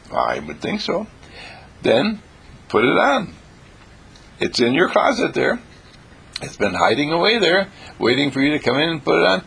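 normal talking voice

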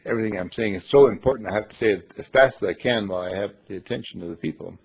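fast, tumbling run of words; racing through without a pause; hurried, excited talk. Shown as a demonstration. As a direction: rush it out before the listeners are lost. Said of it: "talk fast".

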